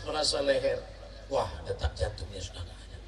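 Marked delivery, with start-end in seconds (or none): none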